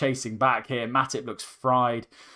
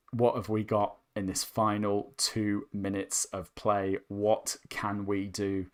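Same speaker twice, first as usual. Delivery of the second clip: tense hush; 5 semitones lower, 7 dB quieter than usual. Real, not acted. Tense final minutes.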